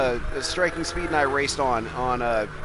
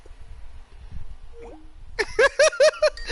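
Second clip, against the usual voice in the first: real wheezing laugh that keeps going; helpless, breathy giggling; amused laughter. Real, not acted. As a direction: crack up at a prank call. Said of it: "it's funny".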